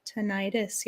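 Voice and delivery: "Tinnitus" as tuh-NY-tus; long-i pronunciation. Shown as a demonstration. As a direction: answer with "Tinnitus", middle syllable rhyming with "night". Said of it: "How do you pronounce that?".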